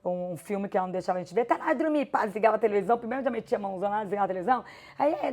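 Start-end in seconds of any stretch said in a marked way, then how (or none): 1.4-2.2 higher, mock yell of a strict mother; mild mock yell of a mother ordering kids to bed; retold mother's yell. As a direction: imitate mom barking kids off to bed. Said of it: "da minha mãe gritar".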